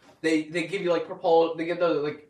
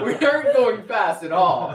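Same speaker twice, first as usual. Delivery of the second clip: laughing through the words, louder; laughing dismay; 3 semitones higher, 7 dB louder than usual. Retold or imitated. Seeing the frail old taxi driver, laughing in dismay. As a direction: laugh at your own bad luck.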